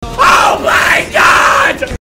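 high, loud frantic pleading; panicking gamer. Real, not acted. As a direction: yell a desperate bribe. Begging player to leave plane.

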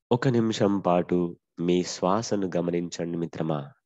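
usual speaking voice

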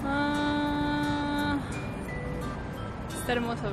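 ordinary delivery

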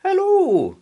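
high, bright sing-song hello; cheery play leader. A narrator's reading. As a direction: greet the child like a friendly teacher. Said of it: "said Missus Sams".

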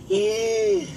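eh agudo y suave, voz fingida; tenedor de chiste. Acted. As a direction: suelta un chillidito agudo para avisar. Un tenedor llama al otro. (tenedor con vocecita aguda)

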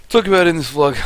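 hiccuping through words; with hiccups. Real, not acted. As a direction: talk between hiccups. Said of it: "Sorry, excuse the hiccups".

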